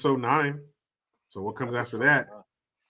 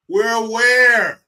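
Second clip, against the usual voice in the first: sarcastic; 6 semitones higher, 5 dB louder than usual. Real, not acted. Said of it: "mocking cancer awareness month".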